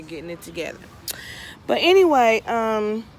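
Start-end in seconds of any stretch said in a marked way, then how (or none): 0.0-0.8 breathy whispered aside under her breath; unvoiced whisper to herself. Real, not acted. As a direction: drop to a whisper as if talking to herself. Muttered aside while gardening.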